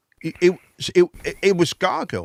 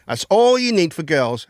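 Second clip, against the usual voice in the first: normal talking voice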